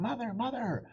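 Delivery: high calling out for mother; crying out. Acted. Boy calling out to his mother as he hurries, high. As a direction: call out for her urgently. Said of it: "cried out Radius".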